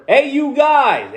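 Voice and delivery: high, slow call to a crowd. Shown as a demonstration. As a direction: hail a group from far off. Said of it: "when you say".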